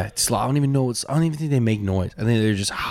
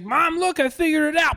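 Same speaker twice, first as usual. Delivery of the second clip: very high shouted tiger voice; tiger impression. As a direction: shriek it like a cartoon tiger. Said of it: "and it's like".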